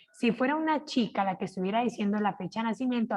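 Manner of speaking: ordinary talk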